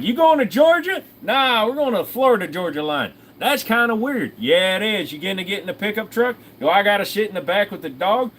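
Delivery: lightly voiced country bumpkin asking about the pickup. Acted redneck road-trip dialogue.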